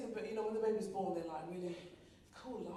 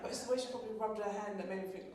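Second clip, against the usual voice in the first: ordinary talk, nothing marked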